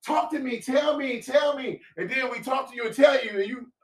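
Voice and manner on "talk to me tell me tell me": mocking the wife. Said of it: "'Cause y'all be like".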